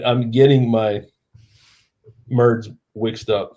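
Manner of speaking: slow, careful "merds mixed up", first sound of "words" swapped to M; deliberately garbled words. Real, not acted. Tipsy host echoing co-host's joke, "merds" deliberately wrong and slow. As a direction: say "merds" for "words" on purpose, pausing before it. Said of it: "say that wrong on purpose".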